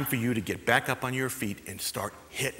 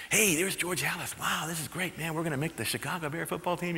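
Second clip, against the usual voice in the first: hushed, eager whisper-talk among rookies; excited rookie murmur; 7 dB quieter than usual. Retold or imitated. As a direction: murmur it to your teammates in awe. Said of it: "they would be murmuring".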